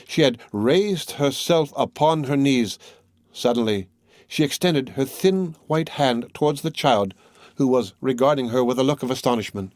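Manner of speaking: ordinary delivery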